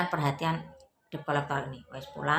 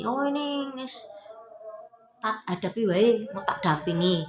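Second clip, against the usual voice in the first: lawyer offering help; 5 semitones higher than usual. Retold or imitated. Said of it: "salah satu pengacara bilang seperti itu".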